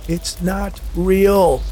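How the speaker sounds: very slow, drawn-out cry, pitched high; shouting. A narrator's reading. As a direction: stretch every word in defiant denial. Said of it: "Hank shouted".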